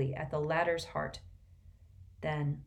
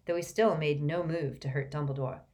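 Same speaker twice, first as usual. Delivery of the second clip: ordinary delivery, nothing marked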